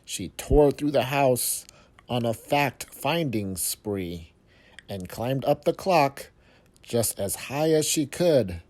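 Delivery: ordinary delivery, nothing marked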